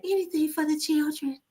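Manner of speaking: [name] impression. Teasing how [name] talked in public. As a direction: float it up high and sweet. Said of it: "[name] talk like that".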